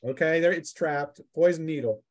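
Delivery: normal talking voice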